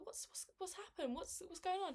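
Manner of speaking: high-pitched imitation of a concerned doctor; concerned questioning. Neurologist to a crying patient, concerned. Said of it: "looking at me a bit concerned".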